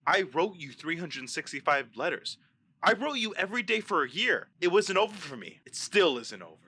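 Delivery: forceful, emphatic film quote; insistent, pressing declaration; aggressive movie delivery. Acted. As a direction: drive each line home like the movie hero. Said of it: "let me read it to you in the way he says it".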